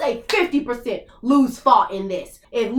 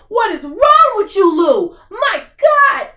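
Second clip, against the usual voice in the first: exasperated shout; 6 semitones higher, 5 dB louder, slower than usual. Real, not acted. Reactor yelling at Lou on screen, exasperated shout. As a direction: yell it at the screen in disbelief.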